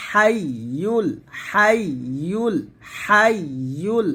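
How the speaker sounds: slow, drawn-out হাইয়ুল with a long doubled ইয়; recited pronunciation. Shown as a demonstration. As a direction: stretch each হাইয়ুল slowly. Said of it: "দেখুন". (ধীরে টেনে হাইয়ুল উচ্চারণ)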